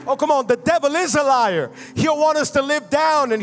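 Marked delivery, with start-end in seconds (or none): none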